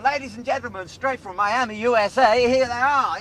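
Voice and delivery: announcer presenting the act from Miami; mock stage announcer. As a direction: build it up like a club compere.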